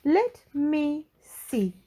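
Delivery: high, very slow prim request; Little Miss Splendid curious. Acted. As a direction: draw it out, curious and coaxing. Miss Splendid asks to look.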